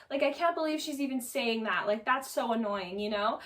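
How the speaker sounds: ordinary talk, nothing marked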